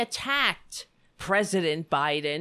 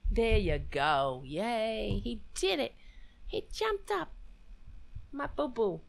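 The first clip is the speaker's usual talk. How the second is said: soft cooing praise for the pet; 5 dB quieter than usual. Real, not acted. Praising the pet who jumped up, soft cooing baby talk. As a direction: coo over him proudly.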